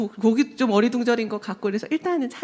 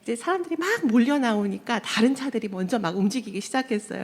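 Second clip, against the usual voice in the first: normal talking voice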